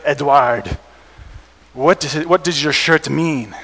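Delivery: lightly put-on European accent. Curious classmate asking about the T-shirt, in a mild European accent. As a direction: imitate a European friend's accent, a touch slow at the end. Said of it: "a European accent".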